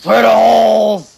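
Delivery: shouted high and slow; slow, high mock battle cry. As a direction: bellow the oath like a rallying cry. Echoed as a shout.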